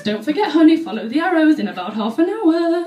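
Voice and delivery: a wife hinting to her husband; mock suggestive.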